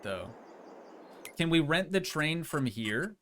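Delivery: usual speaking voice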